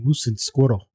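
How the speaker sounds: "squirrel" with a rolled R. Shown as a demonstration. Streamer showing the phrase he loves saying, rolled R in "squirrel". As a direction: roll the R in "squirrel", cartoon Russian style. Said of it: "it's the rolling of the R's".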